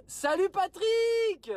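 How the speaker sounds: loud, high, drawn-out hello; called-out greeting. Real, not acted. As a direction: sing out a big hello to a viewer. Greets a live viewer mid-sentence.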